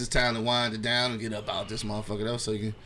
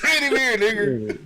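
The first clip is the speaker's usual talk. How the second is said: laughing; 7 semitones higher than usual. Real, not acted. Mocking caller while laughing.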